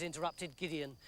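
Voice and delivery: ordinary delivery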